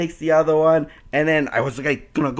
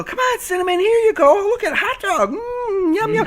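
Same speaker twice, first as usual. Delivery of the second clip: very high, sweet sing-song lure; high coaxing pet voice; luring pet talk. Retold or imitated. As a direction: tempt the dog with a treat. Luring dog with hot dog.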